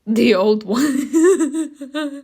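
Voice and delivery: laughing. Real, not acted. Player reacts to the outfit choice, drawn-out laughing. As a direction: stretch it out, then burst out laughing.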